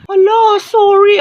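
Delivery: high, loud, alarmed yelp; shrill apology. Acted. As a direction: squeak out a startled sorry. Caught forgetting the report. (gulat at matinis na paumanhin)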